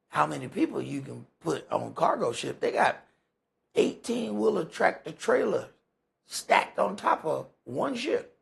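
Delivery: ordinary talk, nothing marked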